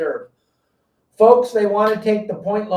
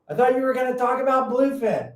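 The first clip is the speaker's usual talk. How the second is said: puzzled audience member; 7 semitones higher than usual. Retold or imitated. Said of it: "people would go".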